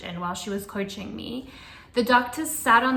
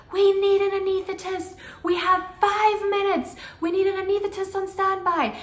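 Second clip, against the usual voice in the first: much higher, loud alarmed call; urgent call; 9 semitones higher, 4 dB louder than usual. Retold or imitated. Head midwife calling outside for an anesthetist, high and loud. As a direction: raise the alarm for the team.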